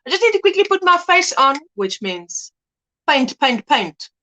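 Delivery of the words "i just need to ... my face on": fast, light everyday phrase; quick, breezy say. Shown as a demonstration. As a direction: rattle it off fast and higher, like a habit. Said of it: "That's how I usually say it".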